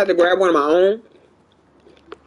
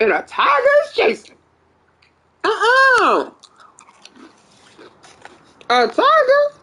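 scared; 12 semitones higher, slower than usual. Real, not acted. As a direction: yelp it out in sudden fear. Sees a tiger chasing them.

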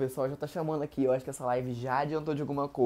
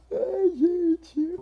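mock despair; 9 dB louder than usual. Overwhelmed by fan reactions.

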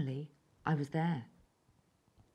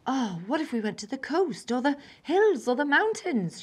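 higher and louder, bouncy; excited exclaim; 8 semitones higher, 5 dB louder than usual. A narrator's reading. Mum excited about a holiday, bubbling over. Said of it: "she exclaimed".

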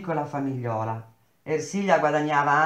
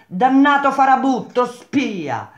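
much higher, much louder curse; furious shout; 10 semitones higher, 9 dB louder, slower than usual. A narrator's reading. Old Lippi screams curses at the foreman, furious. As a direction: hurl the insult with rage.

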